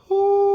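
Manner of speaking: high, loud hoo for them to match; loud high falsetto hoo; high pitch-matching hoo. Shown as a demonstration. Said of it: "Let's try it".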